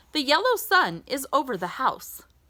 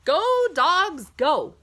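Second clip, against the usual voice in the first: higher, louder go call; cheering; 7 semitones higher, 4 dB louder than usual. Acted. Reading to a child, cheering the dogs on at green. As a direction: urge them forward.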